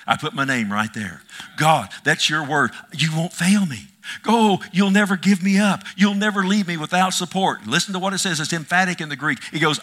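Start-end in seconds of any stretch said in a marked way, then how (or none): 1.6-7.7 rising, louder preacher's declaration; fiery preaching. Acted. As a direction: proclaim it to the congregation, building. Preacher declaring God's promise.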